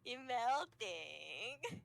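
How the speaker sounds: put-on slow, drawn-out baby talk; cooing. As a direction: coo over a tiny creature.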